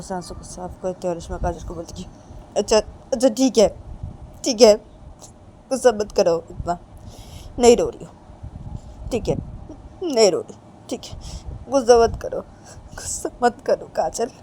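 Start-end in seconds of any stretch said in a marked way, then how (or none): 2.6-14.3 loud, hurried-to-agree appeasing; placating. Acted. Reshma stops crying to calm angry Kajal, placating. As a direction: give in and plead against the anger.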